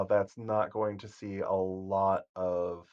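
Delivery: normal talking voice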